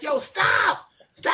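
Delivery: high-pitched drawn-out scream of protest; yelling. Retold or imitated. Boy begging mother to stop whipping, high yelling. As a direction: scream it like a kid getting whipped. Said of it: "I started yelling".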